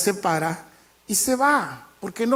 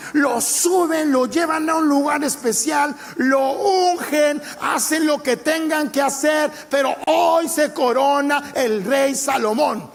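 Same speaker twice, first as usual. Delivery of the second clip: rising proclamation; 4 semitones higher than usual. Acted. Preacher as King David proclaiming Salomón's crowning. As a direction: proclaim it like a triumphant decree.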